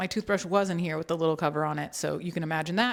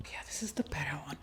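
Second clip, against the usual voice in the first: whisper; 4 semitones lower, 11 dB quieter, faster than usual. Real, not acted. Aside while concentrating on opening.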